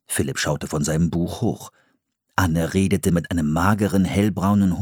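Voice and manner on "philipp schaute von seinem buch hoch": usual speaking voice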